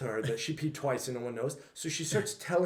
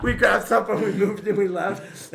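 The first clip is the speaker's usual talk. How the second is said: usual speaking voice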